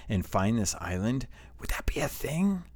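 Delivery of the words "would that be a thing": high whispered wonder; hushed, awed question; whispering in disbelief; excited whisper. Real, not acted. Excited speculation about the game.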